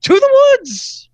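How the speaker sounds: very high, loud and slow; high damsel wail. Pretend. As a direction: hold it out like a swooning heroine. Melodrama damsel climax.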